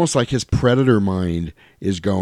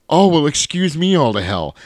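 higher-pitched mock indignation; acted exasperation; 6 semitones higher, 3 dB louder than usual. Podcaster acting out the movie robber's retort, exasperated. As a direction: throw it out as exasperated sarcasm, pitched up. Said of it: "gets this exasperated expression".

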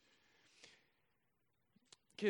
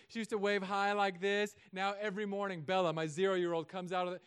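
ordinary talk, nothing marked

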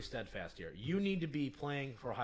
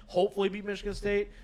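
higher, louder mock of a bland line; mocking neutral; 6 semitones higher, 6 dB louder than usual. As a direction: parrot it back, teasing. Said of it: "that was the most neutral thing".

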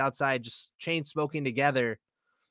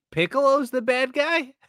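shocked disbelief; 10 semitones higher, 5 dB louder than usual. Retold or imitated. Remembers shocked reaction to movie.

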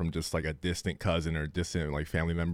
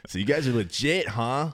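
mock impressed visitor; 5 semitones higher than usual.

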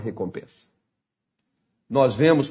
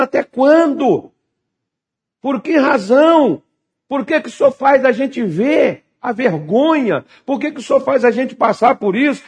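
high, raised-voice desperate questioning; shouting at God; 9 semitones higher, 3 dB louder than usual. Acted. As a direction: demand answers from God, stretching the key words. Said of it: "gritando com Deus ali". (gritando com Deus em desespero)

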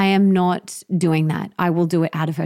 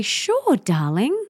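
put-on cheerful lilting yes to a child; sing-song sure darling; 3 semitones higher, slower than usual. Shown as a demonstration. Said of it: "I say it like that".

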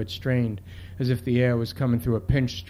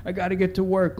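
higher-pitched, lightly acted tearful plea; imitated crying; 6 semitones higher than usual. Handcuffed kid crying to get to work, mildly imitated. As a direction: plead it as a crying kid, understated. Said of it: "The kid's crying".

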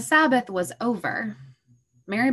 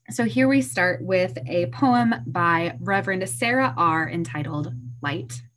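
usual speaking voice

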